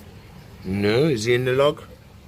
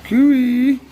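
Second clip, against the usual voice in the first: high, loud sing-song call; excited calling out; 9 semitones higher, 5 dB louder than usual. Real, not acted. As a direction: call out cheerfully to the pet. Calling out to pet.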